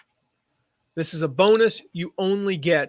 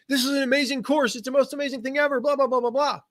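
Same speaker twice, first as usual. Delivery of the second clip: gushing testimonial; 8 semitones higher than usual. Retold or imitated. Other people praising the course.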